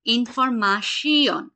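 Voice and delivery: ইনফরমাশিয়ন drawn out slowly, syllable by syllable; careful model word. Shown as a demonstration. Teacher models ইনফরমাশিয়ন slowly for students. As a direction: spell out ইনফরমাশিয়ন slowly for the class. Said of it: "যেমন এটা হচ্ছে".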